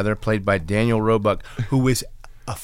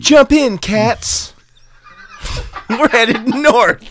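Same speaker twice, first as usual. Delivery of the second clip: high, loud hepcat call; mock hip call; 10 semitones higher, 3 dB louder, slower than usual. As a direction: call it out high like a cool cat. Parody continues.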